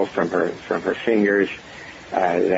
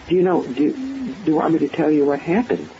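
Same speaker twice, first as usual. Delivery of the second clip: lighter, higher patient's voice; 8 semitones higher than usual. Retold or imitated. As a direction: quote her eagerly, pitched up.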